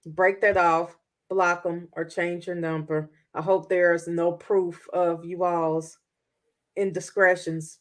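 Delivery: normal talking voice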